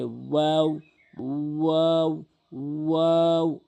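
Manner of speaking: "ওয়াও" drawn out from rounded lips; rounded waw. Shown as a demonstration. Teacher shows learners the letter, "ওয়াও" long and rounded. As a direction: glide slowly through "ওয়াও", held level. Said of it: "গোলাকার করে মুখের খালি জায়গা হতে".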